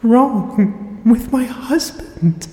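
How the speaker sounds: high, slow, breathless whisper; terrified whisper. Acted. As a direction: gasp it out in terror. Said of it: "a deranged burst of breath".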